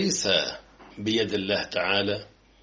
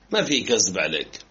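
ordinary talk, nothing marked

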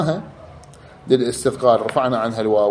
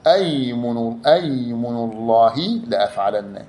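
normal talking voice

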